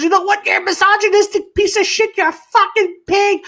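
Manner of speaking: high-pitched mock outraged rant; colleague losing it. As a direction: imitate a furious coworker hurling insults. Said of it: "my colleague just loses her shit".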